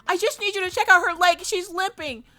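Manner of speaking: high-pitched mock pleading; hammed-up urgent begging; frantic dog owner. Owner begs receptionist to check the leg, mock frantic.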